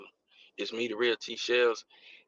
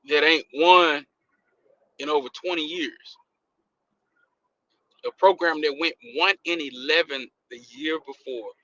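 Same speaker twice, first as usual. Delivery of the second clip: ordinary talk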